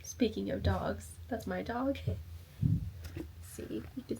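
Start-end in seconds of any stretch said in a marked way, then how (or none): 3.4-4.2 hushed so the dog stays asleep; soft whisper near a sleeping dog. Real, not acted. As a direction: whisper it softly. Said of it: "dog sleeping".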